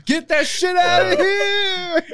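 very high, loud, drawn-out holler; hammed-up shot-blocker shout; mock trash-talk yell. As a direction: scream it slowly in comic triumph.

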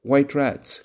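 eager, inspired guess; inspired cry. A narrator's reading. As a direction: blurt out a sudden bright idea. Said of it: "cried Nibs, inspired".

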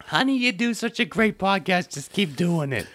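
put-on high, sweet mother praising her kid; doting mom voice. Mother praising her podcasting son, high and sweet. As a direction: coo at your son like a proud mom. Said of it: "where do I pick up the record".

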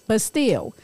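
normal talking voice

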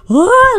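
loud, high battle cry; imitated effort yell. Brother trying really hard at the game, imitated yell. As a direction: yell as if straining to win. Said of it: "he's doing his typical".

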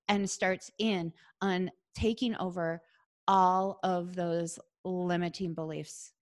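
ordinary talk

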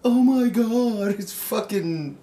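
high, slow fangirl gasp; squealing starstruck fan; mock starstruck squeal. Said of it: "I'm not gonna be like".

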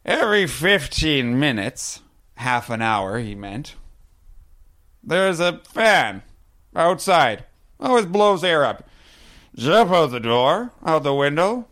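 put-on high, loud, slow drunk voice; drunk bar guy. Drunk at the bar explains the fan trick, high and slow. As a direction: spin the tale like a confident drunk.

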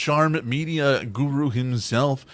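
high, bright announcer welcome; show host intro. Acted. Host welcoming listeners to the episode, announcer voice. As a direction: sell the show opening like a host.

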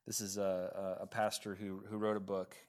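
normal talking voice

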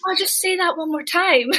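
high-pitched imitation of an enchanted fan; smitten listener. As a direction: mimic someone begging, utterly charmed. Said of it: "And they're like".

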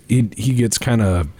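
usual speaking voice

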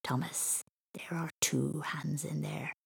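slow, hushed ghostly whisper; soft, drawn-out creepy whisper; spooky voice. Acted. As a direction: whisper eerily to scare a child. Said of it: "a spooky voice".

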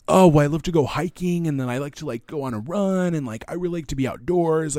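high, lilting mock enthusiasm; imitating outdoorsy dating matches. Match bragging about hiking, mock-gushing and high. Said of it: "and then people are like".

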